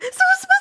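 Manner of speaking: very high, loud breaking scream; terrified scream. Acted. Annabeth falling back from the spiders, panicked scream. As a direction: let the word collapse into a panicked shriek. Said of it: "fell backwards in terror".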